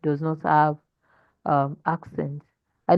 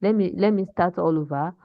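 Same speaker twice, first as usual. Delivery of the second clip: ordinary talk, nothing marked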